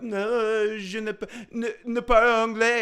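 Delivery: high, hammed-up French accent, stumbling over the words; mock French accent. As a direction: play a stubborn Québécois pretending not to speak English, in a French accent. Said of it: "And they're like".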